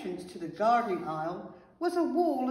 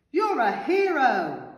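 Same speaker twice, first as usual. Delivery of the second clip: loud, slow, adoring cheer; delighted squeal; 4 semitones higher, 8 dB louder, slower than usual. A narrator's reading. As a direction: hail the rescuer with joy. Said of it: "squealed the veggies".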